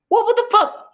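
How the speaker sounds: high-pitched "what, what the fuck"; shocked disbelief. Retold or imitated. Outraged disbelief at rival.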